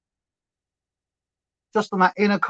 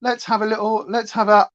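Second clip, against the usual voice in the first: ordinary talk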